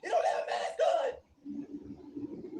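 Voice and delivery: high, frantic mock panic, half shouted; imitating a panicky neighbour. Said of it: "He's going to his wife".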